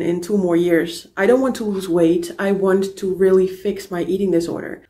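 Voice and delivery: ordinary delivery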